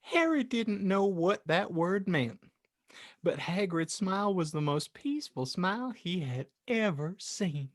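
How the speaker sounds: normal talking voice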